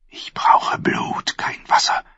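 slow, hissing whisper; queen whispering her craving for revenge. Acted. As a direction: breathe the words with dark menace. Queen's dark vow for blood.

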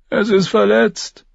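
put-on a scared boy reporting; Lommi. Lommi answering.

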